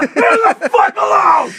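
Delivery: building to a high, drawn-out scream; hammed-up dad screaming at the gulls; exasperated yell. Father yelling at seagulls.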